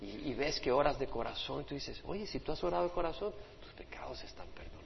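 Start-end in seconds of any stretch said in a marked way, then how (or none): 2.0-4.8 quick whispered assurance; hushed, hurried pardon; fast whisper. Acted. Pastor assures sinner forgiven.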